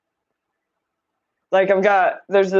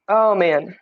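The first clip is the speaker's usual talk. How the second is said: usual speaking voice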